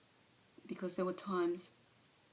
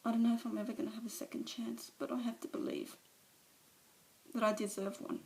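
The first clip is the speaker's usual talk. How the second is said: Alice breaking down before the meeting; tearful confession; 3 semitones higher than usual. Acted. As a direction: let the shame and grief spill over. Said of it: "a monologue from one of, of her movies".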